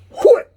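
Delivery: sudden high yelped whoop; mock whoop. Masseuse whooping as she strikes his back, sharp high yelp. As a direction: imitate her sharp loud whoop. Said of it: "she goes".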